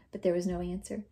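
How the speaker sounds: ordinary delivery, nothing marked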